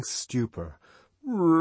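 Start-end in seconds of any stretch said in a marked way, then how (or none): 1.2-1.6 high, loud ecstatic cry; drawn-out joyful exclamation; joyful rapture. A narrator's reading. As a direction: cry it out in sudden delight. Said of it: "as though suddenly and joyfully awakened".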